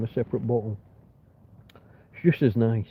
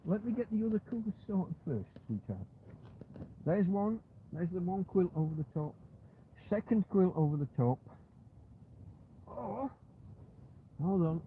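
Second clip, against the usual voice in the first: soothing the dog; 2 semitones higher, 10 dB quieter than usual. Real, not acted. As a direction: gently reassure a cuddling puppy. Cuddling the dog under covers.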